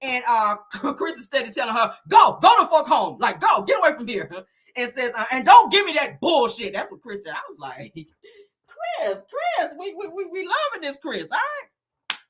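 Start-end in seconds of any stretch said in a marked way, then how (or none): 2.1-4.3 loud, high angry dismissal; mock yell, punchy at the start; retold yelling. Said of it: "Both of them are still yelling". 5.3-6.7 loud, high angry retort; mock yell of indignation; retold yelling. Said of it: "he is yelling at Lexi". 7.9-12.2 high, giggly cheering; tickled, squealing approval; delighted laughing. Real, not acted. Delighted reaction, laughing at Chris.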